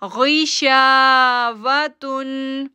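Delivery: गिशावतुन chanted very slowly with शा held two seconds; slow tajweed chant; drawn-out recitation. Shown as a demonstration. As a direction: stretch the शा in गिशावतुन. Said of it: "दो second के लिए खींचेंगे".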